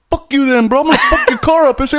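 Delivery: high, loud tough-guy threat; mock threat. Neighbor threatening to wreck his car, hammed-up angry yell. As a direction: sneer it like a street bully.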